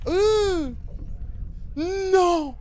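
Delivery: high panicked cry; panicking gamer. Real, not acted. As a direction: yelp in real fright. Jump scare reaction. (cri aigu de panique)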